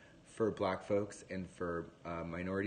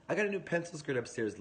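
higher, quick gushing; gushing mum; 7 semitones higher, faster than usual. Retold or imitated. Mum planning outfits with her son, higher excited gush. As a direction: rattle it off in excited delight. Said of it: "what does he say".

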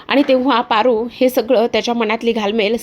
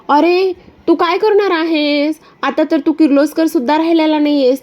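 Disha's sly voice; 4 semitones higher than usual. Acted. Disha calling from her car to Aditya, high and mocking. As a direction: sweet-talk him with a sneer.

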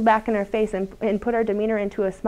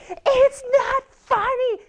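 put-on much higher, slow, drawn-out child whine; whiny tantrum child; 13 semitones higher, much slower than usual. Daughter protesting to her teasing mother, mock whiny child voice. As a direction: imitate the daughter's indignant protest. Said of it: "she'd be like".

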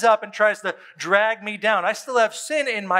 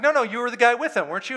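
quick, higher-pitched imitation of an accuser; mock accusation; 6 semitones higher, faster than usual. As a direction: press the question fast, acting out a bystander. Acts out bystanders accusing Peter.

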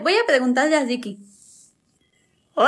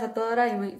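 normal talking voice

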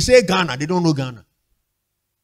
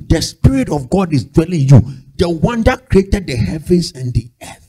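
ordinary talk

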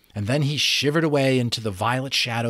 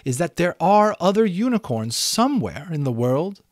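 high, louder pleading unicorn voice; 9 semitones higher, 6 dB louder than usual. A narrator's reading. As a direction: beg for the truth.

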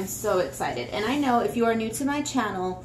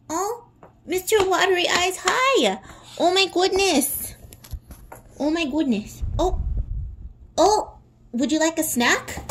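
dog talk; 8 semitones higher than usual. Real, not acted. Greeting the dog Bentley in a high cooing voice. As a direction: coo at the dog.

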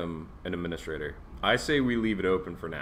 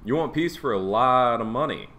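mocking taunt; 4 semitones higher, 6 dB louder than usual. Real, not acted. Taunting enemy asking peace.

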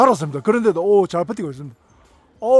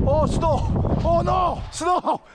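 frightened high yells of stop; scared shouting; 10 semitones higher, much slower than usual. Real, not acted. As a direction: yell in real panic on a ride. Yelling stop while spinning.

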